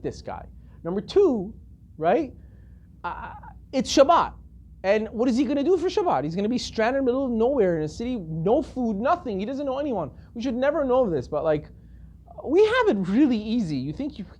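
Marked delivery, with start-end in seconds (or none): none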